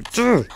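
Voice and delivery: short, high cry of alarm; startled yelp. Real, not acted. Player startled while counting items, short high yelp. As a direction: yelp mid-thought.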